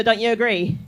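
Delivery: ordinary delivery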